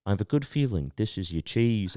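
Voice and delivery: soft put-on accent; hushed showman in a put-on accent; Cheese Man accent. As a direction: put on the performer's accent, quietly. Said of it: "I gotta do his accent".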